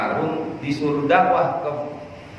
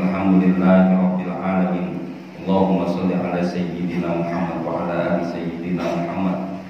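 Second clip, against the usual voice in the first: low, level Arabic prayer recitation; closing prayer; 5 semitones lower than usual. Real, not acted. Preacher leading the congregation in closing prayer, reverent. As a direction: recite evenly on a lowered pitch, slowing at the close.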